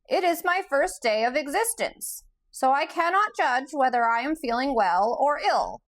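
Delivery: high squeaky voice, too small for a huge body; squeaky Gump voice. A narrator's reading. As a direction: squeak it out like an odd stuffed creature. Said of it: "in a squeaky voice".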